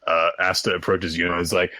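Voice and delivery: ordinary talk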